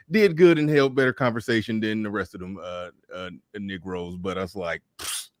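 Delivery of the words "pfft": usual speaking voice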